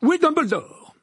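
loud, high peremptory demand; imperious. A narrator's reading. Minister demands Dumbledore of a woman, imperiously. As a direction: demand it at full volume. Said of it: "d'un ton impérieux".

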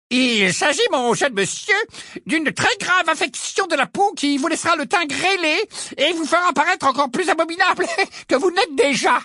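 put-on high-pitched old-time quack diagnosing with relish; portrait of a medieval wizard healer, insistent. Portrait healer tells Ron of a grave skin disease, high and insistent. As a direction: pronounce a dire diagnosis in a high, loud character voice.

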